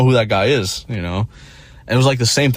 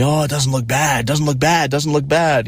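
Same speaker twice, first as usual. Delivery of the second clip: lightly higher mock reassurance; a hint of repetitive fake comfort; mocking everyone; 6 semitones higher than usual. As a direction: parrot them with mild sing-song. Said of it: "everyone's telling me".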